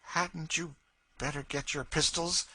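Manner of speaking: a hint of icy mockery; cold sneer. A narrator's reading. Cassie taunts the terrified Legree with a sneer. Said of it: "with a sneer that froze Legree's blood".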